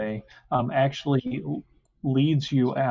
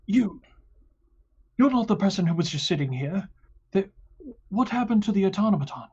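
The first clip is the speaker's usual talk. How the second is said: guard voice; 8 semitones higher, faster than usual. Acted. Guard confronts the stranger.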